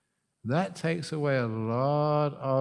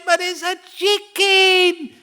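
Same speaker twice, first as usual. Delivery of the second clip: high, loud, drawn-out protest; hammed-up yelling; 10 dB louder than usual. Wife yells at husband over the bird, comically overwrought. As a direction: yell it slowly as the squabbling wife.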